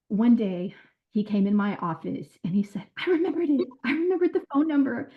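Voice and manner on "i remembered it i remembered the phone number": put-on high, quick excited child's voice; imitating a thrilled little boy. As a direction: blurt it out like a kid bursting with news. Boy announces he remembered number.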